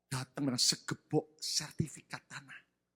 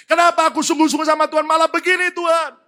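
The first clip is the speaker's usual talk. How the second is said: high, loud, fast outburst at God; angry protest; 14 semitones higher, 18 dB louder than usual. Retold or imitated. Betrayed wife protesting to God, angry shout. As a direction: hurl an outraged complaint to heaven. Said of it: "Protes sama Tuhan".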